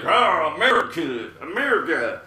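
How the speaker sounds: loud, high mock-patriotic shout; hammed-up nationalistic bellow; super nationalistic. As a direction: holler it like an over-the-top American patriot. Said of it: "may sound like I'm super nationalistic".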